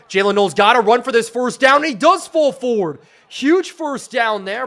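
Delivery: loud, high, building call; excited play call. Real, not acted. Streamer calling a huge first down, excited. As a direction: urge the runner on, then celebrate.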